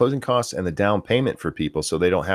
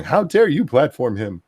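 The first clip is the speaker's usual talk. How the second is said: higher, louder mock outrage; joking indignation; 6 semitones higher, 5 dB louder than usual. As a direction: scold in playful fake shock. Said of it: "jokingly going".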